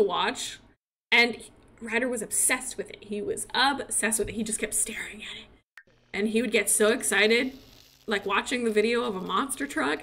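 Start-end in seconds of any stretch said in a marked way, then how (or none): 4.3-5.9 whisper. Acted. Describing son's fixation.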